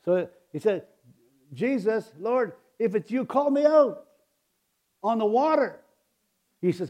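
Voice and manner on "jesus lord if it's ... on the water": Peter calling out. Acted. Peter calls to Jesus.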